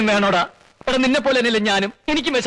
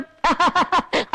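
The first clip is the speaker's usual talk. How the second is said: mocking laugh; 8 semitones higher than usual. Laugh heard, far voice.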